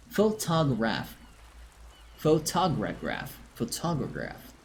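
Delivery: "Photograph" drawn out slowly with the extra syllable; slow mispronunciation. Shown as a demonstration. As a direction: practice the wrong "Photograph" slowly, fading. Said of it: "how I'm going to start pronouncing".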